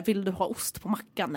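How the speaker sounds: put-on slow careful words to an old lady; soft slow question. Carer asking old lady slowly, softly. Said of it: "det var typ så här".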